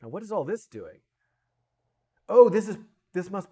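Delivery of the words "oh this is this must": sudden loud high oh; surprised. Real, not acted. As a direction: realization burst out. Realizes what code does.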